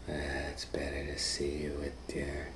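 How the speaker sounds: soft whispered sly wolf voice; whispering wolf as grandma. Acted. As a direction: hush it like a wolf hiding in grandma's bed.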